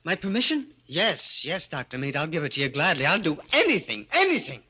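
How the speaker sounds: eager pleading. Acted. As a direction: offer everything at once. Eagerly agrees to anything.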